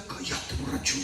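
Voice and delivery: whispered telling-off; imitated discreet whisper; hushed scolding. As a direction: shush a drunk under your breath. Deacon discreetly shushes a drunk.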